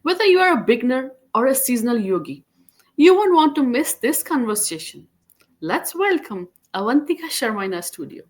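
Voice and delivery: scripted show opener, lifted and emphatic; podcast host introduction. Acted. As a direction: welcome listeners and announce the guest. Presents the episode introduction to listeners.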